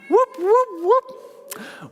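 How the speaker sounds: very high whooping rotor noise. Acted. Teacher makes rotor sounds for preschoolers, very high whoops. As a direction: make the rotors sing. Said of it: "One more time".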